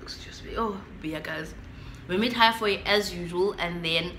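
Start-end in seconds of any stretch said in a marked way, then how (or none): 0.0-1.5 quick whispered aside off mic. Real, not acted. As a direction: whisper an apology to the room. Aside to people nearby.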